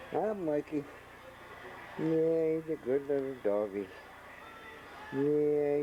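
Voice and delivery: high cooing to a dog; pet talk. Real, not acted. Greeting the dog sweetly.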